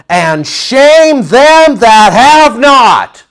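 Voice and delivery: very slow, loud, high declamation; no punches pulled. Acted. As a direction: thunder each word as a rebuke. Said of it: "not pulling back any punches at all".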